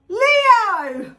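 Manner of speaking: sudden loud high call of the name; shout. Real, not acted. Tarot reader calling out to her husband, shouting. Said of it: "Sorry I shouted into the".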